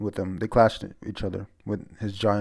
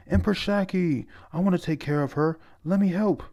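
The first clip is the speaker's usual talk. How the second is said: high eager pleading; 9 semitones higher than usual. Retold or imitated. Eager suitor voiced high.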